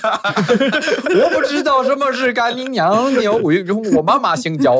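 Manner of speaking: slow and high, breaking into laughs; laughing foreigner voice. Acted. Friends joking about foreigners and swearing, mock foreigner voice laughing. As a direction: play a clueless foreigner through giggles. Mocking a foreigner's confused question.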